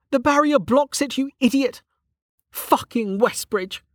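high-pitched exasperated character voice; Velika, exasperated. Acted.

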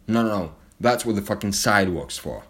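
usual speaking voice

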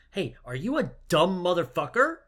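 hammed-up yelling Lupin; angry rant. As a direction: scold him like an exasperated teacher yelling. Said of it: "He's like".

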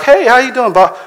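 high, bright put-on churchgoer voice; mock church greeting. Preacher mocking smiling churchgoers, high mock greeting. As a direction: play the fake-friendly church greeter. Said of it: "You know, like".

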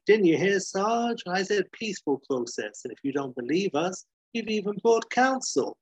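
high-pitched, emphatic biker comeback; biker retort. Acted. Biker taunting the sergeant in story.

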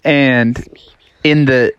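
ordinary delivery

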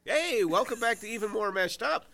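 loud, high hosting intro; bright big show opener; announcer voice. Acted.

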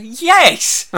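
high, loud comic outburst; shrill-pitched, fed-up retort; exasperated yes. Retold or imitated. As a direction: shout it in mock exasperation. Exasperated at being asked again.

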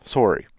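"Sorry" copied with a round O; Canadian pronunciation. Shown as a demonstration. American copying the Canadian's word, "Sorry" with an O. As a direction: imitate a Canadian "Sorry", like "sore-ee". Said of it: "Canadians say very oddly".

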